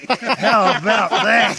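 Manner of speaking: loud, slow, laughing through the words; laughing loudly, drawn out. Real, not acted. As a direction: crack up while asking. Laughing after joke about carriers.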